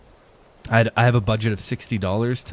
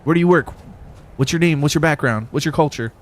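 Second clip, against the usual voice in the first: mock interrogation; 7 semitones higher, 6 dB louder than usual. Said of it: "It's not like".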